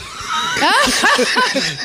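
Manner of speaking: loud high laugh bursting out; burst of laughter. Real, not acted. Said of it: "Jetzt hört auf zu lachen".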